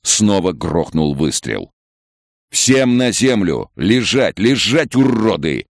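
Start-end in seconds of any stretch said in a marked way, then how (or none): 2.5-5.7 high, forceful shouted orders; authoritative raid shout; commanding voice. A narrator's reading. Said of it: "раздался властный голос".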